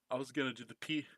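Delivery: normal talking voice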